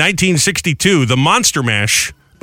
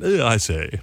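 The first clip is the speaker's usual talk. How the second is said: mock British accent; 3 semitones lower, 5 dB quieter, slower than usual. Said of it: "British accent".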